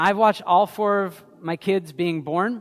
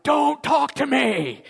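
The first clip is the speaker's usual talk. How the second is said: higher, louder, slow startled yell; groggy yell; 7 semitones higher, 4 dB louder, slower than usual. Retold or imitated. Father woken from a nap yelling at his daughter, startled. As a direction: snap it out like someone just jolted awake. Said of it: "I just woke up yelling".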